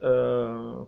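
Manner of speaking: ordinary delivery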